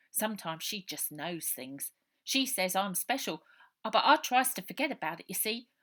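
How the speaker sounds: put-on a scared street boy pouring it all out; orphan boy Robert. Runaway boy telling Ida his story, loud and urgent.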